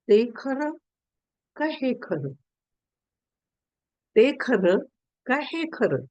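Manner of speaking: pondering question. Retold or imitated. King to all his servants after the dream, pondering question. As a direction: pose it as a weighty riddle, haltingly. Dazed king's repeated riddle question.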